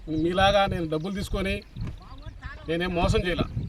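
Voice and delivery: normal talking voice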